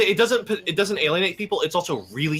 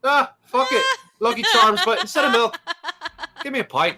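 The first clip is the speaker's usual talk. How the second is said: stage Irish; 11 semitones higher, 4 dB louder than usual. Acted. Hammed-up Irish stereotype joke.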